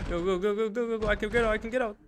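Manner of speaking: high, hurried self-urging; frantic push to escape; breathless-paced escape chatter; urgent. Real, not acted.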